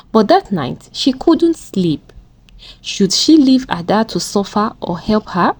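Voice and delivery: ordinary delivery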